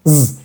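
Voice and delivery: one voiced zzz like a bee; vibrating Z buzz. Shown as a demonstration. Teacher shows audible French difference, buzzing Z. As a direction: hum a buzzing Z from the throat.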